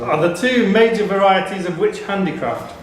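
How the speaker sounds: ordinary talk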